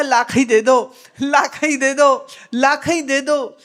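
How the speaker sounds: loud, pleading repeated begging; pleading beggar. Retold or imitated. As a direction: beg loudly again and again. Dreamer begging with eyes shut. (नींद में गिड़गिड़ाते हुए ज़ोर से माँगना)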